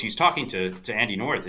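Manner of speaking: ordinary talk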